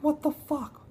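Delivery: high-pitched, baffled cursing; exasperated disbelief. Real, not acted. Baffled by team stalling.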